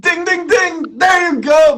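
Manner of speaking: high, loud winning-bell call; excited game-show cheer. Real, not acted. Host cheering guest's right answer, excited shout. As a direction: shout it like a correct-answer buzzer.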